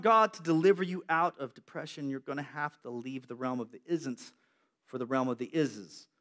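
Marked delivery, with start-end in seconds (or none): none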